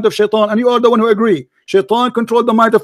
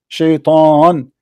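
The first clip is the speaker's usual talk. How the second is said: SHAITAN stretched slowly, in a lower pitch; drawn-out emphasis; 5 semitones lower than usual. Real, not acted. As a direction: stretch "Shaitan" out low and deliberately. Spells out disputed word to opponent.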